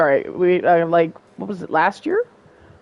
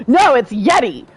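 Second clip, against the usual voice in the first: louder, insistent correction; insistent contradiction; 5 semitones higher, 5 dB louder than usual. Pretend. Gamer arguing with the professor, playful insistence. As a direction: argue back loudly, playfully. Argues back at the game.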